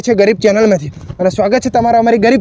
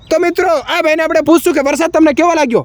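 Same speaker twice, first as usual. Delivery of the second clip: bright, slightly higher host voice welcoming viewers; channel host intro; 6 semitones higher than usual. Acted. Reporter welcomes viewers before interviewing rickshaw driver, host voice. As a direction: sell it like a small-time YouTube reporter.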